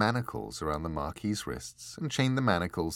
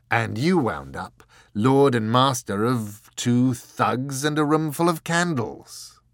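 grinning taunt; 4 semitones higher, 8 dB louder than usual. Acted. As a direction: needle the angel with a grin. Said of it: "The Marquis grinned".

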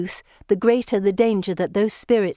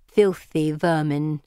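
slow, low sneering creature; Gorgon voice; 3 semitones lower, slower than usual. A narrator's reading. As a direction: spit it out slowly with disdain.

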